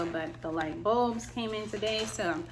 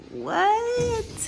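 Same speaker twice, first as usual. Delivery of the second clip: surprised; 14 semitones higher, 6 dB louder than usual. Real, not acted. Surprised at own work.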